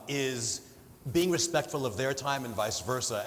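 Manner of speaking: ordinary talk, nothing marked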